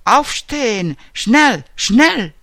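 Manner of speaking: contained barrack yell, rising in force; shouted wake-up orders. A narrator's reading. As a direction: call out a wake-up order, pushing harder on each word. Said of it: "ci svegliarono le solite grida".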